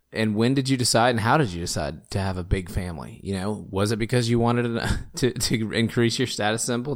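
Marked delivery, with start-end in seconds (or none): none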